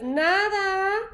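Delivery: lightly voiced friend answering; friend's reply. Teacher voicing a friend's one-word reply, lightly. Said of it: "And she says".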